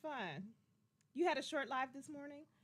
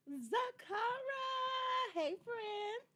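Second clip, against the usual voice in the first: excited greeting; 11 semitones higher, 4 dB louder, much slower than usual. Real, not acted. Greeting a viewer on live stream.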